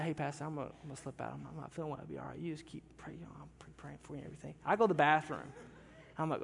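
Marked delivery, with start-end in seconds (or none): 0.0-4.5 hushed, low murmur to the pastor; mumbled quick aside in church; quiet sick murmur. Retold or imitated. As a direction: slip it out quietly, feeling ill. Sick man whispering to pastor.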